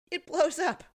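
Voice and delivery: high, loud punchline; dramatic reveal. Real, not acted. Recapper revealing the car explosion, high excited exclamation. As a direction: land the shock twist with gusto. Climax of retold explosion scene.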